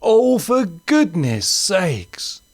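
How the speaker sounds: high, slow, drawn-out sigh of a line; weary put-upon grown-up, very slow; exasperated Martin. Acted. Martin fetching the ball yet again, slow and exasperated.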